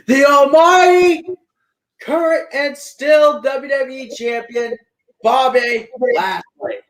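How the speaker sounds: big hyped intro, rising; announcer hype. Acted. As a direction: ring announcer build-up. Hyping the champion's name.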